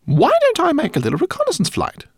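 very high eager rat voice; helpful squeak. A narrator's reading. Rat offering to scout for the friends, eager squeak. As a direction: offer help brightly like a tiny pilot. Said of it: "asked Lola helpfully".

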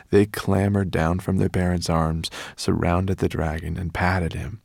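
ordinary delivery, nothing marked

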